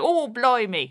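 a hammed-up London "Oh, blimey"; mock Cockney. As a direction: toss off a light Cockney exclamation. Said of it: "we should be going".

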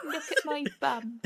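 high, soft giggling cat voice; cat impression. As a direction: purr it like a cheeky pet cat. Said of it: "an impression of Susan, her cat".

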